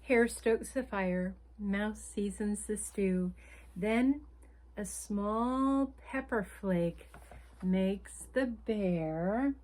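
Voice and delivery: lively picture-book read-aloud; reading to children. A narrator's reading. Storyteller reading a picture book to children, lively. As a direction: read the story like bedtime for little ones.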